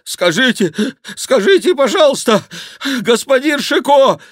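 high, loud cry in broken bursts; breathless monk's cry. A narrator's reading. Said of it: "закричал он". (крик запыхавшегося монаха)

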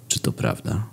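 eerie whispered story ending; creepy whisper. A narrator's reading. (upiorny szept)

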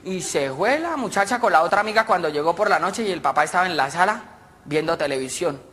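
usual speaking voice

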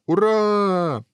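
long, high hurrah; a table full of guests cheering; everyone cheering. A narrator's reading. Guests answering the king's toast, high jubilant cheer. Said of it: "все закричали".

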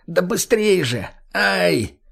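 high, slow voice of a man in pain; hurt man urging. Acted. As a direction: urge them on through the pain. Hurt man shouting in dark.

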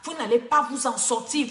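normal talking voice

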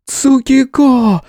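high, long calling cry; woman's cry. A narrator's reading. Kitsune calls the hero for help, crying out. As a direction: call the name out high, drawn out. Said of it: "Крик Рангику заставил вздрогнуть".

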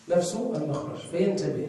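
ordinary talk, nothing marked

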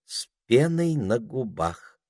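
slow, much calmer repeat; dutiful echo. A narrator's reading. Duchess confirms her husband's boast, slow placid echo. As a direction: echo him slowly and placidly.

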